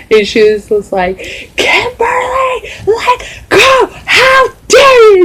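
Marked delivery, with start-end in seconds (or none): 1.6-5.2 very high, drawn-out outraged shriek; imitated yelling mom. Mom flipping out on seeing the pierced ear, imitated high-pitched yell. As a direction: shriek each phrase like an outraged mother. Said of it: "yelling on top of her lungs".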